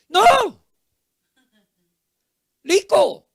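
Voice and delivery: high, loud "no", then "rico" said "liko"; acted fed-up father mispronouncing "rico" with L; exasperated father correcting. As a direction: correct the child forcefully, voice jumping up. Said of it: "Pero papá dice".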